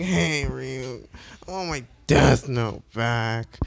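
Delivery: mock lazy plea, slowing down. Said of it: "lazily, like, asks Ryuk".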